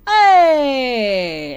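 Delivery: long, loud, high "hey"; calling out. Real, not acted. Streamer greeting the cheese queen herself, long loud call. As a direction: holler a drawn-out greeting.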